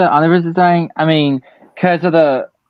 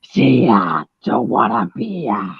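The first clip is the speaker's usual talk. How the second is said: raspy Ghost Freak voice; 4 semitones lower, 3 dB quieter than usual. Pretend. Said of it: "Ghost Freak's voice, the raspy one".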